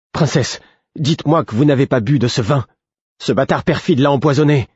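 high-pitched, urgent alarm; dramatic declaration. A narrator's reading. Cobb to the princess about poisoned wine, dramatic alarm. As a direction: plead and accuse with full melodrama. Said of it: "Sa déclaration dramatique".

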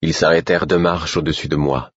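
plain reading voice

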